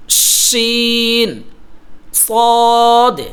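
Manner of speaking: ordinary talk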